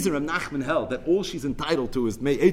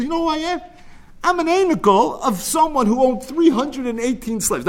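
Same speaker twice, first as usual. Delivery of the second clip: put-on indignant old woman; 9 semitones higher than usual. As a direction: play the insulted old woman demanding respect. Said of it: "She says".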